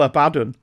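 Abaddon loud and falling, as uh-BAD-un; Abaddon repeated. Shown as a demonstration. Podcaster retries the listener's correction, Abaddon firmly pronounced. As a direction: say Abaddon firmly with a weak final syllable. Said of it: "emphasis on the last syllable".

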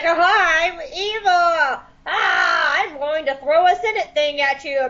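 cackling evil bad guy gloating; mustache twirling villain. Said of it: "mustache twirling villain, where he's just like".

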